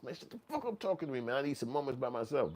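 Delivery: high, rushed griping; exasperated plea. Acted. Inmate brushing off a chatty neighbor, high rushed exasperation. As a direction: blurt it out high, wanting to be left alone. Inmate wanting to be left alone.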